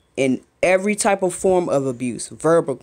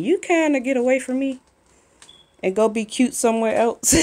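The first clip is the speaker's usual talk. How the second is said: playful shooing; 9 semitones higher than usual. Real, not acted. Shooing daughter out of shot.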